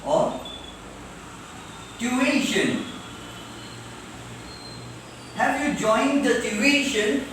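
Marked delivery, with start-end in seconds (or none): 2.0-7.2 TUITION as "tyu-I-shun", middle syllable stretched; correct tuition. Shown as a demonstration. Teacher modelling the rule, TUITION with stressed middle "i". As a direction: lean on the "i" in TUITION. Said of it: "Not tuition".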